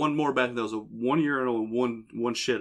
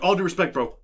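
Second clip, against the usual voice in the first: mock urgent address; 6 semitones higher, 5 dB louder than usual. Acted line to man leaving stall.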